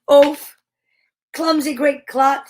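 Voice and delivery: punchy, scolding call; story-time mock shout; mild shout. A narrator's reading. Little folk scolding the clumsy giant, mild shout. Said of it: "they shouted".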